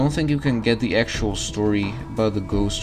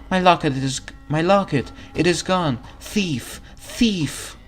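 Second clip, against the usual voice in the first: distraught ghost; 8 semitones higher than usual. Acted. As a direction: build to a frantic cry of thief. Ghost girl discovers theft.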